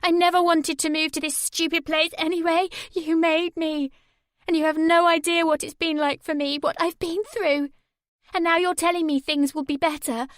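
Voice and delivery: high, loud shouting rant; furious rant. A narrator's reading. Sammy raging at her mum on the phone. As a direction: hurl every line at her. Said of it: "Sammy shouted".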